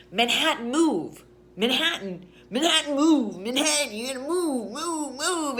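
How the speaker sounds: high, loud, insistent shouting; screaming drunk. Retold or imitated. A man standing over a sleeping passenger, screaming. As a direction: yell it over someone like a ranting drunk. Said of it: "screaming at me".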